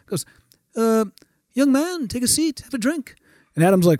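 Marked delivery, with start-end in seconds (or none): 0.7-3.1 higher-pitched genial star impression; imitated older musician. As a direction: invite in a put-on high lilt.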